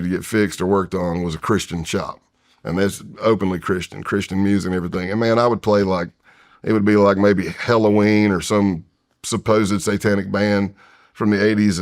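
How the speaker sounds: ordinary talk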